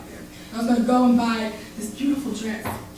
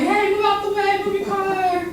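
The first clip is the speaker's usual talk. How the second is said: higher mock shout at a stalled car; impatient drivers; 8 semitones higher than usual. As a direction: play annoyed drivers yelling to clear the road. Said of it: "And everybody's like".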